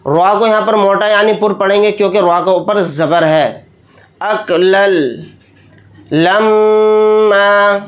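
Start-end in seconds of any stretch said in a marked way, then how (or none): 4.2-7.8 slow chant, long held vowels; Quran recitation. Acted. Teacher reciting the final words. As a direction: draw out अकलल लम्मा slowly.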